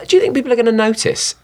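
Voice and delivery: playful Irish lilt, pitched well up; mock Irish accent. Actor joking about doing press in Irish, mock Irish accent. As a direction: ask it in a put-on Irish accent. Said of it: "just do Irish".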